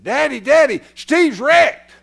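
put-on high, loud boy's cry for Daddy; alarmed shout. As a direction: yell it like a kid reporting a crash. Child alerting father to a crash.

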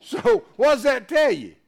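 loud, high fervent preaching; preaching at full force. Acted. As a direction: drive the point home to the congregation. Preacher raising the pitch.

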